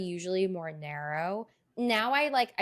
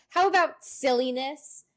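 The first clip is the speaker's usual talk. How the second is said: mock-earnest quote, higher and louder; mock courtroom objection; 6 semitones higher, 5 dB louder than usual. Podcaster mocks a silly line from the courtroom script, incredulous retelling. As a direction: pitch it up and louder, quoting an absurd line.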